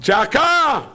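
high, drawn-out shout; loud demonstrated outcry; crying out. Shown as a demonstration. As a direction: cry it out at full pitch. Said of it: "מה זה צעקה".

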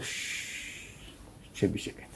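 whispered shush, sit quietly; hushing whisper. Acted. Rabbi hushing the panicked merchant in a whisper. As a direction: whisper him into silence.

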